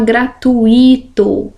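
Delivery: "gratuito" wrongly as gra-tu-Í-to, stressed I; pronúncia errada. Shown as a demonstration. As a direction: break U and I apart in "gratuito", stressing the I. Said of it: "nada de falar gratuito". ("gratuito" com I tônico, em hiato: gra-tu-Í-to)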